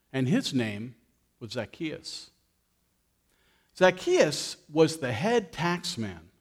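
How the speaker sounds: gentle storyteller reading Luke to the church; scripture reading. A narrator's reading. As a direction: read the story to the congregation. Reads scripture story to congregation.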